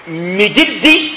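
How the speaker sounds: "مجد" drawn out slowly as "Mijdi"; kasra on meem. Shown as a demonstration. Teacher introducing the man's name, slow "Mijdi" with i. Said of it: "بكسر الميم أيضًا".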